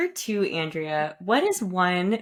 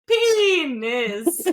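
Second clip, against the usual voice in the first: loud, high, stretched-out slip; hammed-up replay of a slip; retold accidental blurt; 6 semitones higher, 5 dB louder than usual. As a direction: blurt it out big, laughing at yourself. Retold accidental blurt, brain fart.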